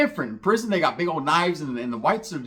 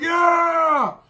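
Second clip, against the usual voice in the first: long, high shouted cheer; imitating bikers yelling assent; retold gang roar; 13 semitones higher, 5 dB louder than usual. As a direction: roar back as the whole crew. Retold bikers roaring back.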